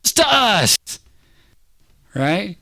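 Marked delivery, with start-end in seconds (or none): 0.0-0.8 anguished high cry to God; high-pitched, pleading outburst. Acted. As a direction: cry out to God under duress. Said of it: "he's crying out saying".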